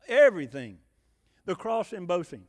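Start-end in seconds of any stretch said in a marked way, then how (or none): 0.1-0.8 much louder, punched repeat; emphatic shout. Acted. Preacher shouts the repeated word.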